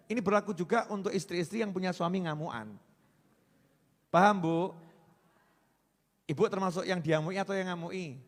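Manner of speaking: ordinary delivery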